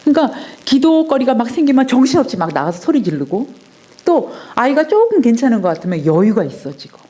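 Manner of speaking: ordinary talk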